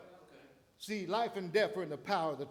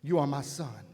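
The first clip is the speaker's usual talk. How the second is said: God speaking; 3 semitones lower, 8 dB louder, slower than usual. Retold or imitated. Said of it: "He said".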